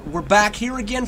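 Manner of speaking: ordinary talk, nothing marked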